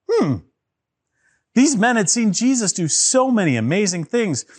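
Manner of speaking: ordinary delivery, nothing marked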